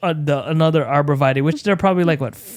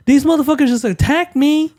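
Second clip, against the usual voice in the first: indignant; 9 semitones higher than usual. Retold or imitated. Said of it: "I was like".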